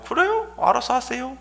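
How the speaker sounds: very high, light agreement; easy assent. Acted. Listener suddenly giving in to a complainant, light high assent. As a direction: concede breezily in a high voice. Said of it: "갑자기 그 사람이 말 듣고".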